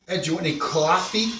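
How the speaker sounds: a light imitation of his North Jersey coffee accent; mock coffee accent. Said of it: "making fun of my coffee accent".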